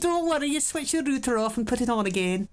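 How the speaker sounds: mock Scottish tech support; calm a caller in lilting Scottish; wee Scottish accent. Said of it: "wee Scottish accent".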